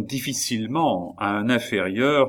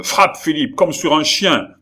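high, loud order to strike; angry command; 7 semitones higher, 7 dB louder than usual. Acted. Baron ordering Philippe to beat Gilbert, loud angry command. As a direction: bark the order at the son. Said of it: "s'écria le baron".

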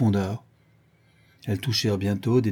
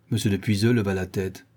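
usual speaking voice

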